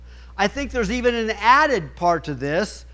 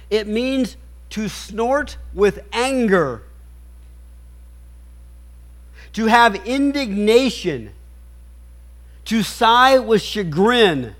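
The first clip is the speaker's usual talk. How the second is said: slow emphatic preaching; 3 semitones higher, slower than usual. Acted. Preacher explains the Greek word.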